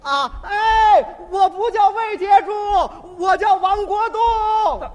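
loud high slow yell over the shoulder; shouting back. Retold or imitated. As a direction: bellow back a correction. Brother shouts back while riding.

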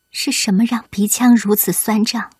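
ordinary delivery, nothing marked

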